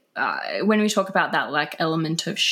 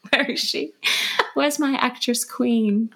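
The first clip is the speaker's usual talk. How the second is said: imagined admirer; 6 semitones higher than usual. Acted. Imagines someone looking for her.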